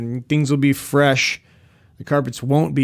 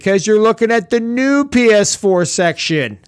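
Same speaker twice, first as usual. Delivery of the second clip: raised-pitch mock know-it-all retort; smart-ass correction; 6 semitones higher, 3 dB louder than usual. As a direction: imitate her correcting you, high-pitched. Retold saleswoman's smart-ass correction.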